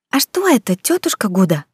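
put-on high, eager child asking; bright curious little girl. Little girl asks the cook.

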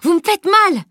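very high, urgent cry; pained protest. A narrator's reading. Girl whose arm is wrenched, pained protest. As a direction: cry out against the hurtful grip. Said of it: "protesta-t-elle".

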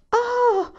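high, loud squeal of surprise; shriek. A narrator's reading. Carrie sees the presents, high surprised shriek. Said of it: "strillò Carrie".

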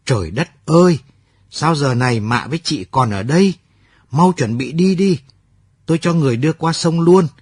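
high, loud alarmed outburst; shouting in alarm. A narrator's reading. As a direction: burst in yelling at them to hurry. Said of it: "anh đã la lên". (la lớn, hoảng hốt, giọng cao)

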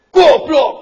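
put-on shouting Goblok at a child; angry shout. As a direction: bark the insult at the child at full volume. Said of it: "harus dengan teriak".

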